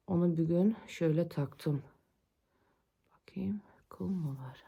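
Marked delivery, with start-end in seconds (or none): 3.3-4.6 whispering to herself; unvoiced whisper while checking closely. Real, not acted.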